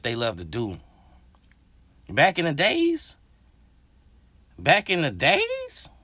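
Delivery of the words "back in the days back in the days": high, slow, sing-song back in the days; wistful high drawl on days; drawn-out nostalgic call. Real, not acted.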